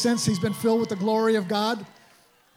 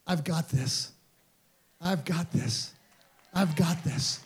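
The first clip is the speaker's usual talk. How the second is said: a hint of a gentle Jesus voice; reassuring Jesus; 4 semitones lower, slower than usual. Jesus reassuring wife in vision.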